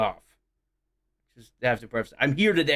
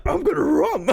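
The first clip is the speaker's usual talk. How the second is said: much higher dog voice with r for c; Scooby mangling his consonants; cartoon dog gag; 13 semitones higher, 3 dB louder than usual. Scooby-style r for c.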